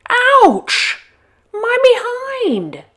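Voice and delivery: a very high, loud yelp of pain; howling wolf. Acted. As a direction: howl as horns hit your behind. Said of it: "howled Lou".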